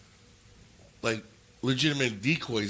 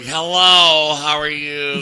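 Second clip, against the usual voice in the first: loud, drawn-out flamboyant greeting; mock-flamboyant, belting out a sing-out hello; flamboyant caricature; 3 semitones higher, 12 dB louder, much slower than usual. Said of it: "like, all flamboyant".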